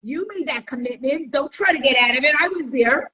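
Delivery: high, laughing, teasing witness voice. Retold or imitated. Wedding witness teasing the couple, high and gloating. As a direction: wag a finger like a gleeful witness. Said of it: "It's like".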